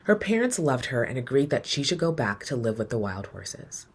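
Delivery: ordinary delivery, nothing marked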